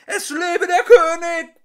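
high, loud roar with a gurgle; gurgling roar. Acted. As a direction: bellow it, throat gurgling. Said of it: "brüllte ... mit gurgelnder Stimme".

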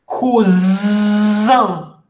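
"Cousin" loud and clear, koo-ZAN with voiced z; careful pronunciation. Shown as a demonstration. Teacher models "Cousin" loudly for the class. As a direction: project "Cousin", nasal end, z between vowels. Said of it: "Attention à la prononciation".

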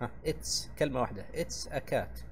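ordinary talk, nothing marked

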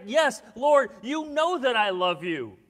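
loud, high, emphatic declaration; strong, emphatic. Retold or imitated. Peter responding to Jesus, strong and emphatic. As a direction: belt out the yes and keep it forceful. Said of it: "responds back with a strong, with an emphatic".

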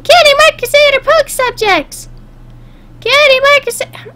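very high-pitched filly voice; cartoon team cheer. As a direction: chant it in a squeaky high voice. Voices the little fillies' cheer.

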